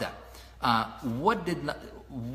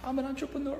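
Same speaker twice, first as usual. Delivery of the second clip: much higher, small mock-timid voice; timid newbie mockery; 8 semitones higher, 5 dB quieter, faster than usual. Coach mocking people who call themselves newbies, high mock-timid. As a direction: mimic a nervous beginner. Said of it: "Or are you just like".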